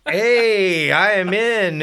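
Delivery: high and loud, all in; eager yes. Retold or imitated. Young player accepting Joe's gig, loud and eager. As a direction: jump at the offer.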